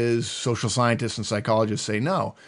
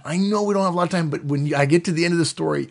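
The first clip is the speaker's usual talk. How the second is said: insistent high I; 6 semitones higher, faster than usual. Acted. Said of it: "They love to go on tangents".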